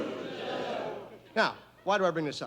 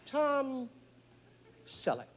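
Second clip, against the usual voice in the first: Saint Peter calls; 6 semitones higher, 5 dB quieter, much slower than usual. Acted.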